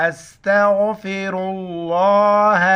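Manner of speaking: drawn-out, careful recitation; slow recitation. Shown as a demonstration. Teacher modelling the fifth kalima, slow careful recitation. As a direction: recite it slowly as a model for students. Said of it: "پنجم کلمہ استغفار".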